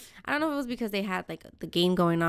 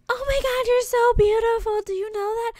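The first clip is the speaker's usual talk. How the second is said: put-on high, loud, cooing baby talk to a pet; neighbor lady baby talk; 14 semitones higher, 6 dB louder than usual. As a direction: gush at the cat like a doting lady.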